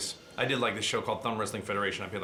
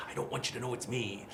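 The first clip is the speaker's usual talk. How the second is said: softer, rasped villain voice; 7 dB quieter than usual. Shown as a demonstration. Actor answering fans in his villain voice, softer rasp. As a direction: say it in the character's hushed rasp. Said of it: "I'm like".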